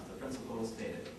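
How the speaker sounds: ordinary talk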